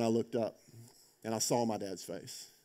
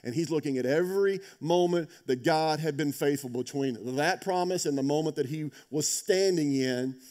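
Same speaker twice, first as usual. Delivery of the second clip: ordinary talk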